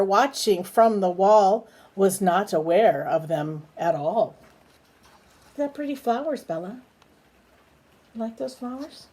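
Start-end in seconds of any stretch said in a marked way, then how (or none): 5.0-9.1 high, soft, quick cooing. Real, not acted. Parent showing baby Bella the flowers, soft high coo. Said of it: "parent talks to baby".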